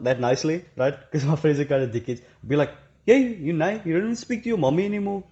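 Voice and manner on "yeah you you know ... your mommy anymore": high, mocking jab at a mate; teasing friend. Friend teasing him about his mommy, high and mocking. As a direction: rib him like a cheeky friend.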